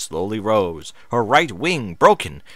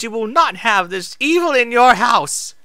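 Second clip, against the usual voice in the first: bitter mock quote; 12 semitones higher, 6 dB louder than usual. Moon Shadow mimics Celestia's words.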